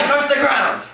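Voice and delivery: loud, high curse; hammed-up curse. Father cursing alongside his wife, loud and high. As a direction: hurl it at the ground.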